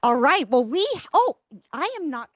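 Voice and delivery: ordinary delivery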